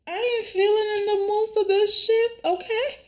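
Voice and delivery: high-pitched mock-crying voice; imitated tearful refusal. Imitating Katie refusing her husband Bill, tearfully fed up. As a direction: whine it high, as if weeping.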